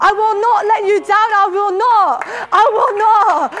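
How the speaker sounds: building proclamation. Acted. Preacher declaring God's promise over the people, building loud. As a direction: build it up to a ringing I will not.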